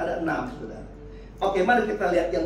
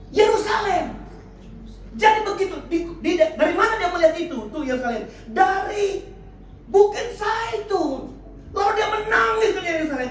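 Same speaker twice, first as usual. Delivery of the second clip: raised sermon voice with drawn-out key words; impassioned preaching; 7 semitones higher, 3 dB louder than usual. Real, not acted. Preacher telling congregation Jesus wept over Jerusalem, impassioned preaching. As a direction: declaim the verse, then fire questions at the congregation. Preaches on verse just read.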